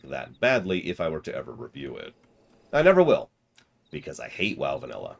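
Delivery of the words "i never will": loud, high I never will; a loud flat refusal; emphatic refusal. Real, not acted. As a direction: blurt it out firmly. Emphatic refusal in review talk.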